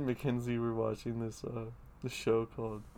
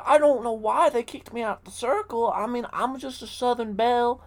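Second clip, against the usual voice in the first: put-on Southern accent; 12 semitones higher, 9 dB louder than usual. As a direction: play a flustered old Southern lady. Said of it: "She's like".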